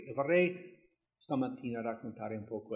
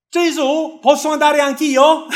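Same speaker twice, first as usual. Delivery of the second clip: much higher and louder, eager begging; eager plea; 14 semitones higher, 18 dB louder than usual. Acted. Peter eagerly begging Jesus to let him walk on water, high excited plea. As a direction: ask like a thrilled kid to join in.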